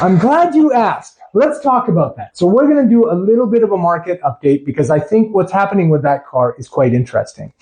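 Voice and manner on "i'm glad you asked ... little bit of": mock showman voice. Mock grand answer to friend.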